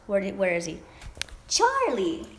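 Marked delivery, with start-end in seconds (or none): 1.5-2.3 high, loud sing-song call; calling the dog. Real, not acted. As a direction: call the puppy from across the room.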